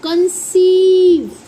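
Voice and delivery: कंसीव loud, drawn out as kun-SEEEV; emphatic long SEEV in कंसीव; demonstrating pronunciation. Shown as a demonstration. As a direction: model कंसीव, stretching the ee. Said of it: "इसे हम बोलते हैं".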